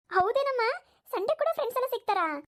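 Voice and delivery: put-on high, eager child voice; delighted child. Child asks mother about Sunday friends, high and delighted. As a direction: ask with thrilled surprise.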